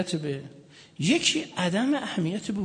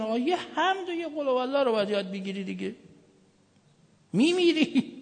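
mock scolding; 7 semitones higher than usual. Preacher retelling how they scolded pilgrims, mock yell. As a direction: warn him, rising to a shout at the end. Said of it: "گفتن".

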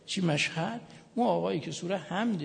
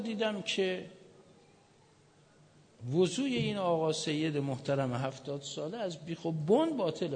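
normal talking voice

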